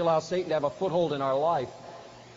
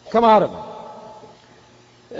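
loud, drawn-out command, raised in pitch; stern rebuke; 5 semitones higher, 8 dB louder, much slower than usual. Acted. Jesus orders the demon out of the man, stern rebuke. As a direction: order it out, slowly and forcefully. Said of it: "Literally, Jesus rebuked".

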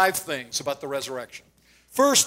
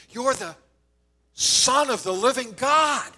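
high, slow, ringing declaration; blurted declaration; 7 semitones higher, slower than usual. Retold or imitated. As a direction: proclaim it as Peter's great realization, pitched high. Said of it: "Peter blurts out".

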